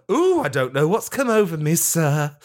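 high, coy swooning butler; flirty robot butler. Acted. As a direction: coo it like a flustered flirt. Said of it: "pinches his tin nipples and says".

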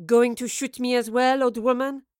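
mock the old woman; villain sneers at the mother. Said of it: "she asked in a mocking tone".

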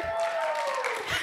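high-pitched joyful whoop; celebratory squeal. Real, not acted. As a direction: let out a high squeal of relief. Declares, voice is back.

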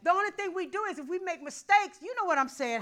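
high, loud preacher's drive; preaching. Acted. Pastor preaching to his church, loud and driving. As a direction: push it to the congregation.

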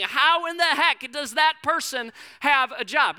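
slow, high-pitched mock indignation; retold anger. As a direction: demand it word by word, comically outraged. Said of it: "you too asked the exact same thing".

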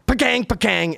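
sharp, high pool-shot sound effect; imitated ball clack. Son mimicking dad's pool shot, sharp high clacks. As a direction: punch out two cracking impact noises.

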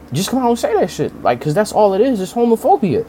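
high, insistent and worked up; heated rant. Real, not acted. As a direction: hammer the point home.

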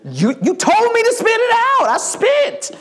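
very high, squealed self-defence; hammed-up kid's outraged squeal; indignant child protesting. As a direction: whine back at mom in disbelief. Child protesting to angry mother.

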